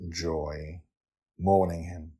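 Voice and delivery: usual speaking voice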